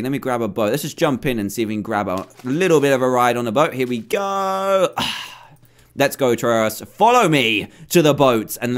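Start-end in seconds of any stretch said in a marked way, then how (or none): 3.7-4.9 slow, sing-song launch call; drawn-out here we go. Real, not acted. Gamer jumping from the ship, slow stretched cheer. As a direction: stretch it slowly as you leap.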